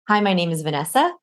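"Vanessa" rising at the end like a question; name asked as question. Shown as a demonstration. Coach shows a common mistake, "Vanessa" in question inflection. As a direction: introduce yourself, lifting "Vanessa" as if unsure. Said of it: "that's asking who you are".

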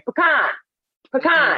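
high-pitched calling across a store; mock call to get attention; retold calling out. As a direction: call the nickname out, each one separate. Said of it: "I just hear".

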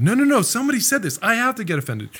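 high, fast mock protest; mock indignant. Mocking the congregation's urge to be offended, high and fast. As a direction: mimic someone insisting on offense.

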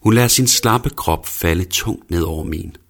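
ordinary delivery, nothing marked

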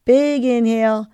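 ordinary talk

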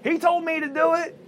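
high, whiny blame; whiny Eve. Acted. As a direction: point the finger in a high whine. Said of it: "Eve's like".

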